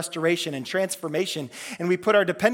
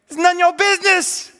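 a higher, louder repeated rebuke; stronger rebuke; 11 semitones higher, 7 dB louder than usual. Retold or imitated. Jesus repeats it to Peter, louder and higher. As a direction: land it harder the second time.

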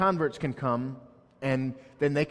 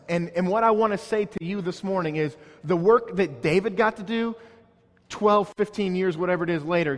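raised, higher pulpit voice building the point; fervent preaching; 5 semitones higher, 5 dB louder than usual. Acted. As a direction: preach it to the congregation with fire. Preaching at full force.

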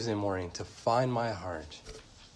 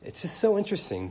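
excited; 10 semitones higher, 5 dB louder, faster than usual. Real, not acted. Preacher marvels at the text.

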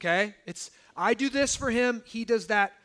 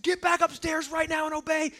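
retold yell; 6 semitones higher than usual. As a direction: bark the command like a fed-up dad. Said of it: "I yell at my daughter".

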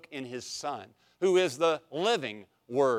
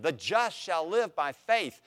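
ordinary talk, nothing marked

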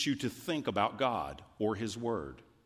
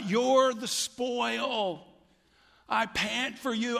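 high, ecstatic voice of devotion; rapturous delight; 12 semitones higher, 4 dB louder, much slower than usual. Acted. Pastor voicing the psalmist's delight in God, rapturous and high. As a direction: gush it up high with wonder.

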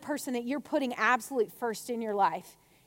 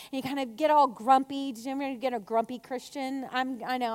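ordinary delivery

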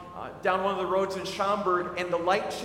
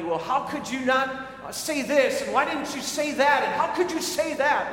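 higher, louder, indignant objection tossed back with a laugh; mock indignant emailer; 6 semitones higher, 5 dB louder than usual. Preacher voices an email critic protesting his words, mock indignant. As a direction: play the offended critic, half-joking. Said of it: "before you start emailing me".

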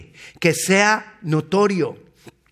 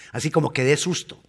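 usual speaking voice

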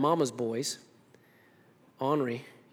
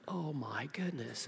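hushed, slow whisper of amazement; awed whisper; 12 dB quieter, slower than usual. Acted. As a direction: whisper it slowly, stunned by the sight. Awed apostles witnessing the Transfiguration.

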